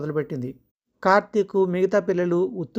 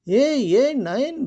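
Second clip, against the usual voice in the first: very slow, higher and louder mock spook; mock scary boo voice; 6 semitones higher, 4 dB louder, much slower than usual. Husband in a mask hamming up a spooky call to his wife. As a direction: drag it out to frighten her.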